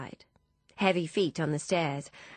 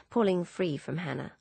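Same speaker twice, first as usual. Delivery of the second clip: ordinary delivery, nothing marked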